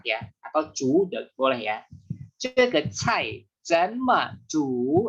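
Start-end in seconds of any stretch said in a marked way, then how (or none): 2.4-5.1 slow model sentence. Shown as a demonstration. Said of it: "slow model sentence".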